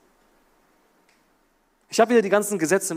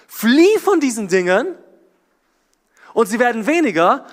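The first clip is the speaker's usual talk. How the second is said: urgent preaching; 6 semitones higher, 7 dB louder than usual. Real, not acted. Preacher urging congregation.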